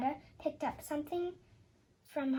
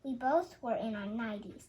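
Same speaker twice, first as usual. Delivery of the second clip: ordinary delivery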